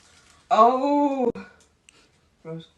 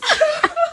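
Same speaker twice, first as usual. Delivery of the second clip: high, loud burst of delighted laughter; squealing laugh; 6 dB louder than usual. Real, not acted. Friend's gift arrives, squealing laugh. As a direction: laugh out with joy.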